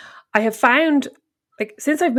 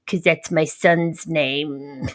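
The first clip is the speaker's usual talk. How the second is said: low, slow, contained rage; retold rage; 8 semitones lower, much slower than usual. Neighbour answering the child about her son's name, in a rage. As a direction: draw it out low and slow, seething. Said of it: "In a rage".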